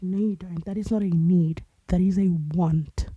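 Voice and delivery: a hint of a mother's firm refusal; stern mom. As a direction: imitate a mom laying down the rule, hitting the key words. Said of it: "And she's like".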